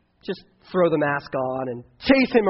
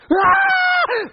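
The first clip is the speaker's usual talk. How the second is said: put-on very high, loud shriek of a scared kid; imitated terrified scream; 5 dB louder than usual. Speaker imitating his little brother screaming at the mask.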